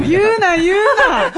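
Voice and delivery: laughing protest. Real, not acted. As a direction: beg him to stop through laughter. Laughing stop him spoiling.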